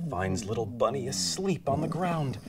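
usual speaking voice

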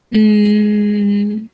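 normal talking voice

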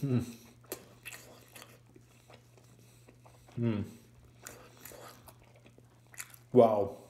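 muffled through food; eating burger while speaking; mouth full, chewing. Real, not acted.

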